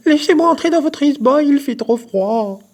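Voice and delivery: high, loud rabbit yelp, slowing at the end. A narrator's reading. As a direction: yap to be let in from the cold.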